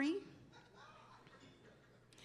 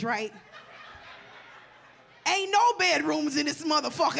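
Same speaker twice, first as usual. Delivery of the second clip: ordinary talk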